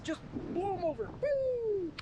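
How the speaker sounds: excited whoop. Real, not acted. Wind knocking cameras over.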